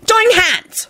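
high-pitched bossy call; bossy shout. A narrator's reading. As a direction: order them about in a high voice. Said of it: "Dorcas shouted bossily".

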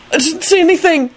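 high, embarrassed denial; flustered high mumble; quick mumble. A narrator's reading. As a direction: deny it hastily in a high voice. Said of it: "Neville mumbled quickly".

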